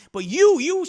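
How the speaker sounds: loud, high mock accusation; accusing. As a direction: jab back at him as the wronged girlfriend. Woman turns accusation on man.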